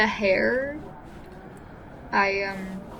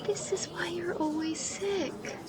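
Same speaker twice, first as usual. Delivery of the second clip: whispered tease; 5 semitones higher, 8 dB quieter, slower than usual. Acted. Sister whispering a jab at Micah in the café. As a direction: whisper it, softer and slow. Teasing aside at the counter.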